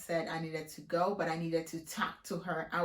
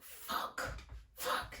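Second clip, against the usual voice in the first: whispered curse; 10 dB quieter than usual. Retold or imitated. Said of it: "I'm like".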